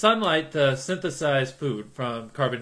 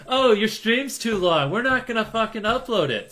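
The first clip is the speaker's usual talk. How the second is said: mock YouTube voice; 8 semitones higher, 4 dB louder than usual. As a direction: sneer it as the snooty platform. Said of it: "YouTube was like".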